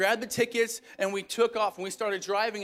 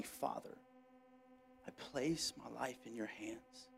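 hushed, barely voiced, far softer; near-whispered last words; 9 semitones lower, 19 dB quieter than usual. A narrator's reading. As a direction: breathe it out as the final prayer. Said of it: "Jesus called loudly".

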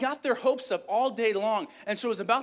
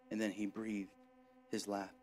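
dramatic scripture reading; 5 semitones lower than usual. Acted. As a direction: tell the passage like a solemn story. Reads Luke passage aloud.

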